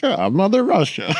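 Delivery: imagined Russian pilot stepping out, mock Russian accent. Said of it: "just like".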